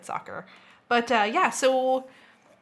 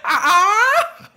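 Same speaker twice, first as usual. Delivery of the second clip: high, loud pterodactyl screech; shrill bird squawk; 12 semitones higher, 10 dB louder than usual. Retold or imitated. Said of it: "her voice were".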